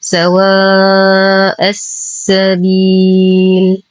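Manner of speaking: سواء السبيل slowly, aa and final ii held long; long madd. Shown as a demonstration. Teacher recites for learners, long held vowels in سواء السبيل. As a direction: stretch سواء and the ending of السبيل. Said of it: "يمد بمقدار اثنين، أربع، ست حركات".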